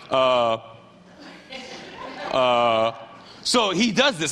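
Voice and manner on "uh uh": low, droning mock 'uh'; dim, baffled hesitation. Peter about to say the obvious, low baffled hesitation. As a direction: drop low and stall, nonplussed. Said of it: "the guy who says the obvious".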